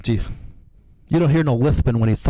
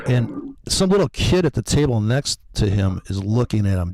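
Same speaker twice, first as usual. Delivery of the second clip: usual speaking voice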